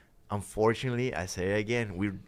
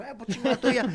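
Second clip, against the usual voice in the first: mock retort; 14 semitones higher, 4 dB louder, faster than usual. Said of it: "el otro".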